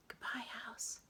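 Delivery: put-on girl whispering goodbye to the treehouse; whispered. A narrator's reading. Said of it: "whispered Annie".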